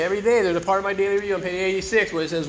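ordinary talk, nothing marked

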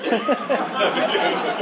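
a breathy, voiceless laugh; unvoiced laugh. Real, not acted. After his cheeky reply to the cop, a breathy laugh. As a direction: laugh at your own comeback.

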